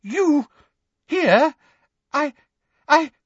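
high, halting disbelief; stammer, beside yourself with amazement; astonished fragments with pauses between; amazed, stammering. A narrator's reading. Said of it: "beside himself with amazement".

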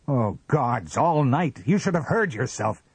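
loud, high, exasperated outburst; almost screaming. A narrator's reading. Husband rants about her night of sleep-talk, almost screaming. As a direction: rage at a high pitch. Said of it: "he almost screamed".

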